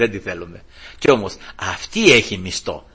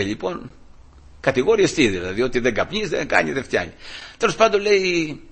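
ordinary talk, nothing marked

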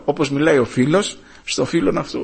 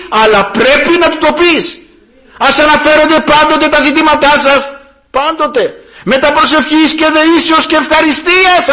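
high, raised preacher's voice; full-force preaching; 13 semitones higher, 7 dB louder than usual. Real, not acted. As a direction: drive the exhortation home to the congregation. (έντονο κήρυγμα με υψωμένη φωνή)

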